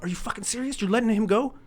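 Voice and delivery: high, loud mock outrage; outraged brother. The brother protests to the cop in high, loud mock outrage. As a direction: squeal it like a wronged man, high and loud. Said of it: "As if it was somehow comparable".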